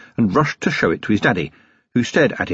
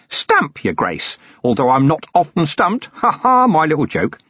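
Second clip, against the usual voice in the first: higher, eager rambling hermit voice; a cheerful, long-winded hermit eager to explain; obliging old hermit rattling on to the Commander, pitched higher; chatty old hermit; 8 semitones higher than usual. Acted. Hermit introduces himself proudly.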